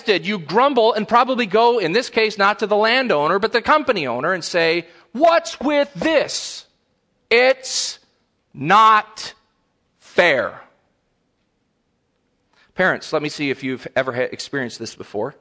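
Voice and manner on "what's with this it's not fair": high, drawn-out mock outrage; indignant complaint. Envious worker complains to the company owner, indignantly. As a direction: protest loudly, stretching out each word.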